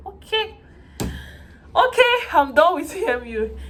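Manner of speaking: mock-triumphant cry of relief. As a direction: celebrate being finally done.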